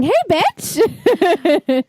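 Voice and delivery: drawn-out high mock greeting; playful catchphrase. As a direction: stretch out the bitch. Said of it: "I just like saying".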